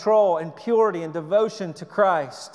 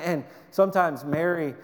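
normal talking voice

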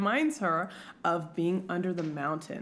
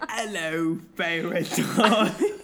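lower, stretched mock Cockney with laughter; mimicked Cockney accent; 4 semitones lower, 4 dB louder, slower than usual. Co-host mimicking the botched voice, mock Cockney accent. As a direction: parrot the bad Cockney, drawn out. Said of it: "horrific Cockney accent".

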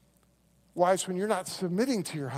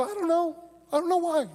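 flustered retold reply; 11 semitones higher, 7 dB louder, faster than usual.

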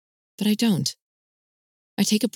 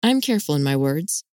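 plain reading voice

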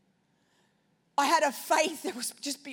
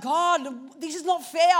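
complaining; 3 semitones higher, 6 dB louder, slower than usual. Retold or imitated. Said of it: "I'm like".

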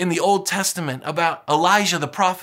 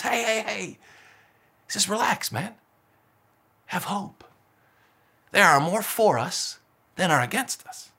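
much softer, soothing hush; calm reassurance; 4 dB quieter than usual. Retold or imitated. Elijah to his servant, soft reassuring calm. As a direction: settle a panicked friend gently.

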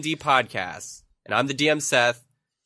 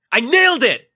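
thrilled shouting, high; excited shout; 14 semitones higher, 10 dB louder than usual. Acted. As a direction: yell it out in triumph. Celebrating after audition.

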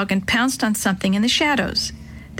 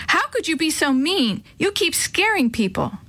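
put-on higher, exasperated child's reproach; upset scolding; 7 semitones higher than usual. A narrator's reading. Sister scolding her brother for scaring people, upset. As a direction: scold him in a raised pitch.

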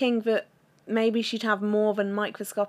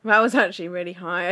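normal talking voice

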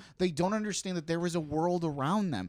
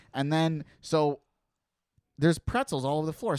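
ordinary talk, nothing marked

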